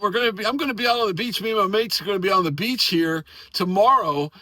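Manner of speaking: ordinary delivery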